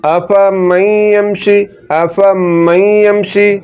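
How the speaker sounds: अफ़मन यमशी with the noon taken into the nose and held long; nasal ghunna idgham. Shown as a demonstration. Teacher recites to students, noon nasalised and held long. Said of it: "नून के आवाज़ को नाक में ले जाकर थोड़ा सा लंबा".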